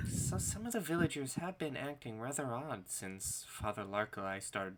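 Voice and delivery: soft-spoken villager woman; woman in the corner. Acted. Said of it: "the woman in the corner says".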